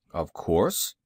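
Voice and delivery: lightly voiced man, pitched low; low-pitched man's reply. A narrator's reading. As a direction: answer low, as the stern man. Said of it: "answered the man's voice".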